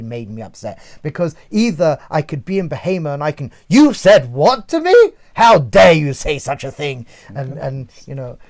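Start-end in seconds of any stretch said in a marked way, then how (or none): 3.7-7.1 loud, indignant English outburst; acted-out outrage. Answering a work colleague's remark from the animal self, acted-out outrage. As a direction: play the affronted colleague, far louder than usual. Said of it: "when I act out".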